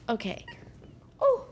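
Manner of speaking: ordinary delivery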